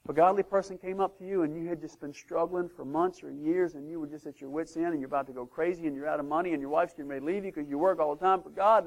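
ordinary delivery

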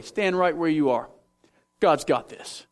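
usual speaking voice

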